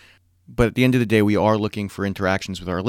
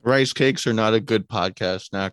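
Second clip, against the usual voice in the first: words round a mouthful; mouth full. Real, not acted. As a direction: talk while still chewing. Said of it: "Can you hear me chewing?".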